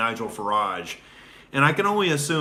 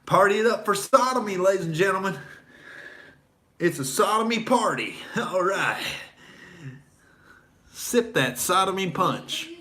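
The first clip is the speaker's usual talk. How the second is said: higher-pitched, sneering party hype; mock party hype; 6 semitones higher than usual. Mocking Hollywood to the audience, sneering mock hype. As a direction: hype the party like a sarcastic host.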